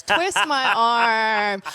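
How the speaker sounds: slow, laughing through "twist my arm"; mock reluctance while laughing; teasing her co-host that she'll slip into the accent. Real, not acted. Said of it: "I already hear just a little bit coming out".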